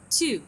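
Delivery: "Two" begun high and loud, gliding down; falling tune. Shown as a demonstration. Teacher demonstrating another word to students, high falling glide. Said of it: "نبدي من نبرة عالية إلى نبرة ناصية".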